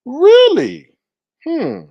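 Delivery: a loud, high, disbelieving really; incredulous really. Real, not acted. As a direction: sound stunned and outraged. Outraged disbelief repeated.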